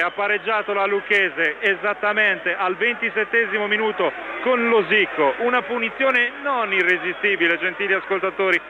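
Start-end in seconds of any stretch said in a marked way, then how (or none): none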